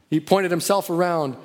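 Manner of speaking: ordinary talk, nothing marked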